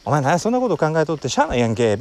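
quick, offhand Kansai dialect. Shown as a demonstration. Showing how Kansai people talk without minding the notes, in quick Kansai dialect. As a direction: rattle it off in casual Kansai speech. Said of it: "関西弁ってね、".

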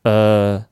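"うー" as a long er with mouth barely open; slow, level, closed-mouth "うー"; muffled vowel. Shown as a demonstration. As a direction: hold a muffled "うー". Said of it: "音をこもるような形で".

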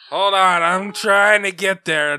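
ordinary talk, nothing marked

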